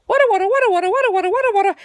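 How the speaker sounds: high, loud pouncing growl-chant; loud war cry. A narrator's reading. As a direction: charge the tablecloth with a battle yell. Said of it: "one loud".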